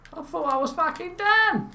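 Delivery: high, outraged disbelief; exasperated. Real, not acted. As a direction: rage at the game. Respawn fails, outraged disbelief.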